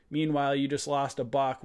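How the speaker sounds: ordinary delivery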